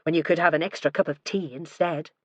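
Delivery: plain reading voice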